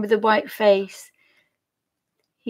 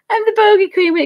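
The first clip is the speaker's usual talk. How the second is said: higher and louder, hamming up bogey; playful proud declaration; 12 semitones higher, 8 dB louder than usual. Real, not acted. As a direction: crown yourself the bogey queen with a grin. Repeats the joke, amused.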